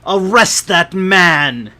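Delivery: slow, high, booming command; burgomaster's yell. A narrator's reading. Burgomaster ordering a man's arrest before the crowd, loud high yell. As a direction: yell it out, drawing out each word. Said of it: "and yells".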